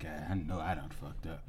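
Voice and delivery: soft, mock regretful mutter. Scared driver after the crash, softly regretful. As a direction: quietly admit the mistake. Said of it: "he just sitting there like".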